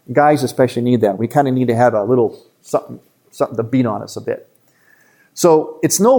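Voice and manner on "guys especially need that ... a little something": normal talking voice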